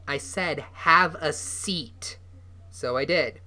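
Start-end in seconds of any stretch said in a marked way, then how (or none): 0.7-2.2 very slow, drawn-out, louder command; insistent repeat. Acted. Shelley repeating the order to Noah, slow and insistent. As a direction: repeat it slowly so it is not a request. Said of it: "repeated after refusal".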